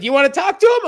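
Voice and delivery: very high, fast, coy taunt; high sing-song mock taunt; mock high teasing girlfriend. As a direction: tease him like a tipsy girl. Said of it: "And then she's like".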